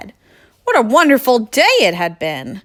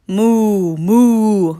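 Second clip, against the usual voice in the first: moaning cow moos, a bit louder; 2 semitones higher, 4 dB louder than usual. Acted. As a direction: moan out a cow's moo. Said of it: "moaned the hens".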